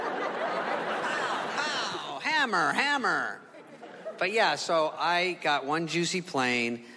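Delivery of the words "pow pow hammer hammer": mock pow and hammer sounds.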